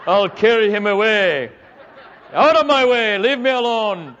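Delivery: loud, hammed-up strongwoman bellow; Helga. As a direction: bellow it like a comic brute. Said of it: "maybe she looked like Helga".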